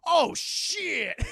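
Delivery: high, loud dismay; hammed-up shocked curse; mock alarm. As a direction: exclaim as a monster caught off guard. Godzilla's retold alarm.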